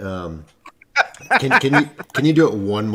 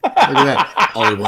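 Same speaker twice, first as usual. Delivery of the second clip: higher, laughing out the words; delighted laugh at the comments; 11 semitones higher, 4 dB louder, slower than usual. Real, not acted. Seeing the live comments pop up, laughing delightedly.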